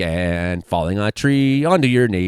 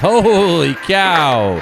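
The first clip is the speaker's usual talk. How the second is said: shocked; 6 semitones higher, 4 dB louder, much slower than usual. Real, not acted. Shocked by tree fall.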